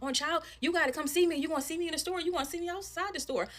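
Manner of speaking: high-pitched mouthing off; imitated confrontational mother. Mother daring the woman to fight, high-pitched and combative. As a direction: mimic an irate mom issuing a challenge, pitch up.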